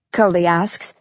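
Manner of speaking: ordinary delivery, nothing marked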